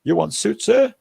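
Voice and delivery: lightly voiced Thai tailor, rising up high at the end; hint of a Thai street tailor calling out; mock tailor's sales call. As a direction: imitate the tailor's singsong pitch. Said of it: "they come past and say".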